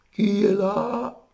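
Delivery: qui est là très aigu, traîné; le loup déguisé imite la mémé; loup en fausset. Acted. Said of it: "qui imite maintenant la vioque". (fausset très aigu et lent)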